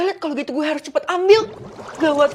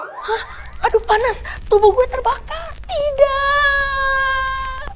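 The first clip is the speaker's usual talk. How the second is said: high anguished cries of burning; agonized high wail; dying scream; 11 semitones higher, much slower than usual. Acted. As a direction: scream as you burn away. Demon burns from spell.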